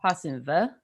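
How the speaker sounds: put-on German word in a Southern accent. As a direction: say Hassenpfeffer like a Southerner. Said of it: "Trying to say a German word in a Southern accent".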